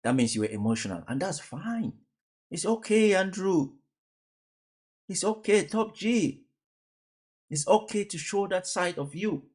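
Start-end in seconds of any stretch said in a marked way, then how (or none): 1.1-9.4 higher, soft mock soothing of a crying man; playful there-there, higher pitched; mock comforting. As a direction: pat him on the back with your voice, teasing. Consoling Tate, mock tender.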